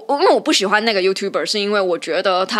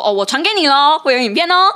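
sing-song, higher and louder mock taunt; 5 semitones higher, 3 dB louder than usual. Mocking the member posting paid videos in the group, taunting. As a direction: dangle it teasingly in their face.

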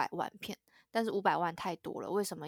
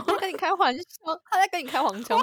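high, loud incredulous outburst; loud, high-pitched astonished question; shocked disbelief; 10 semitones higher, 10 dB louder, faster than usual. Real, not acted. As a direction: burst out in amused shock, pitch way up. Listener shocked by doctor's remark.

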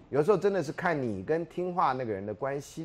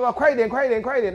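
high, loud, pushy urging; hurrying someone; 9 semitones higher, 8 dB louder, faster than usual. Retold or imitated. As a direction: rush him across the street.

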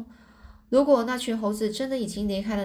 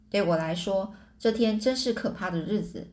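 ordinary delivery

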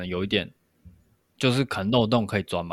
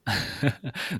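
short laugh; 3 semitones higher, 6 dB quieter than usual. Real, not acted.

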